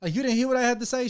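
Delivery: retold yell upstairs; calling out. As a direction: shout up the stairs, mock annoyed. Husband retells calling upstairs.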